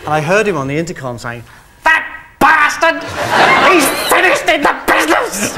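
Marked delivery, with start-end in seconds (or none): 1.8-5.5 much higher, louder mock fury; hammed-up outburst from the offended host; imitating host's rage. Host raging over intercom.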